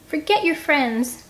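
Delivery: high, slow whiny gripe; griping. Acted. Scarlet complaining to her friends, high slow gripe. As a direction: drag it out like a sulky kid. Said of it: "Scarlet gripes".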